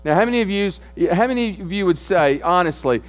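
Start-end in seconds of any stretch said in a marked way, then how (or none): none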